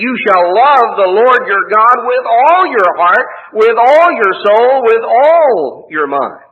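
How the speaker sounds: loud, slow, high proclamation of the commandment; proclaiming scripture. Acted. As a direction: ring it out slowly, every "all" lifted. Preacher proclaims Jesus' great commandment.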